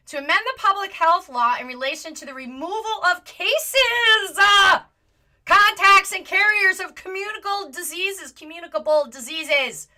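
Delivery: loud, high outraged reading; shouting the bill text out in disbelief; yelling. Real, not acted. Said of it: "I'm sorry I'm yelling".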